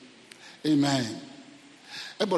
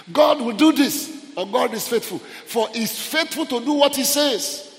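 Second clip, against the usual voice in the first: high, slow, ringing proclamation; full-force preaching; 10 semitones higher, 6 dB louder than usual. Real, not acted. Preacher assuring congregation God is faithful, full-force preaching. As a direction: proclaim it loudly, drawing the words out.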